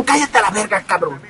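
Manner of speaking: low, soft grumbled put-down; dismissive retort. Acted. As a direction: mutter it under your breath, dropping low. Muttered retort to imagined complainers.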